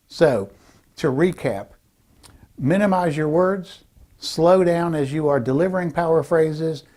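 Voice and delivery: usual speaking voice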